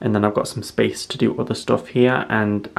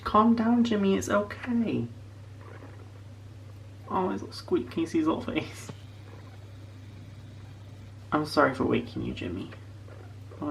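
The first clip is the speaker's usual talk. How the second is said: cooing at pet; 5 semitones higher, 10 dB quieter than usual. Real, not acted.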